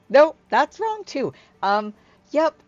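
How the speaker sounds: high, very loud self-correction; flustered Hyla. Acted. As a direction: catch yourself in a big, high character voice. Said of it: "She's like".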